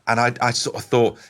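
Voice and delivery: ordinary talk, nothing marked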